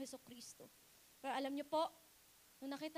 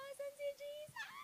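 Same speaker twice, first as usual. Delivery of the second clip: much higher, softer, lightly voiced woman; Mary's searching cry; 9 semitones higher, 6 dB quieter than usual. Preacher voicing Mary searching for Jesus, high and soft. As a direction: ask it like a lost, grieving woman.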